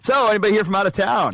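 mock magician. Mock magician working the room.

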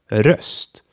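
röst with a short half-open ö. Shown as a demonstration. Teacher showing röst to learners, short vowel. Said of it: "the short half opened ö".

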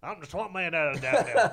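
gravelly swamp man, loud and laughing. Pretend. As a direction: growl it like a swamp creature. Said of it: "say words in a gravelly voice".

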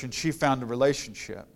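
ordinary talk, nothing marked

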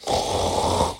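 imitated breathy pig grunt. A narrator's reading. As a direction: grunt like a piglet, all breath. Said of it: "when it grunted again".

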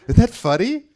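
asked through a high burst of laughter; laughing. Real, not acted. Laughing at God's joke.

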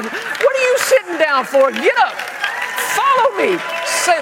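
high, loud, exasperated orders; hammed-up drill-sergeant parent; mock parental shouting. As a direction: bellow at the child to get up. Acted parent barking orders.

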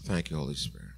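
soft, low worshipful thanks; quiet prayer. Real, not acted. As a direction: pray it softly and reverently.

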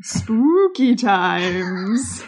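drawn-out eerie "Spooky Times". Shown as a demonstration. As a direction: stretch "Spooky" slow and haunting. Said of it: "you have to say it, like, spooky".

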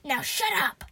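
loud, snapped yell; exasperated shout. A narrator's reading. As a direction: yell to silence a nag. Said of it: "I yelled".

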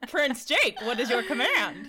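loud, laughing nerdy robot. Retold or imitated. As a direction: giggle through a nerdy robot voice. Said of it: "like, nerdy voice".

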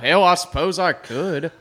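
lightly voiced Billy Bob, unhurried; Billy Bob impression. A hint of Billy Bob, laughing about his one line. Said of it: "I can't say anything else as him".

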